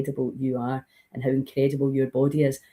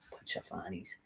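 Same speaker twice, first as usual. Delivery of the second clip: unvoiced whisper of scorn; whispered dismissal; 8 semitones lower, 22 dB quieter than usual. Friend retelling her old dismissive whisper. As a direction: breathe it out scornfully. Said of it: "she would normally have avoided that and go".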